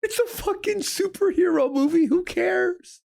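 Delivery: high-pitched, exasperated disbelief; incredulous dismissal. Real, not acted. Co-streamer mocking tears over a superhero movie, incredulous. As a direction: protest it in a high voice.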